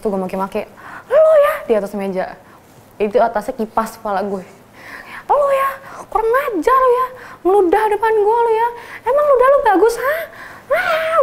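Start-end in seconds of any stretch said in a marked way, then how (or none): 1.1-1.5 high loud snap; retold scolding. Bully yelling at the junior who spat, loud high. 5.3-11.1 retold yelling. Scolding a junior classmate.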